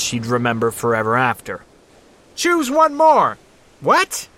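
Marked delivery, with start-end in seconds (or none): 2.3-3.4 high, loud, slow yell; shouting over machinery. A narrator's reading. Co-pilot calls from the helicopter. 3.8-4.3 high shouted question; shouting over machinery. A narrator's reading. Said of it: "Jen yelled over the roar of the helicopter".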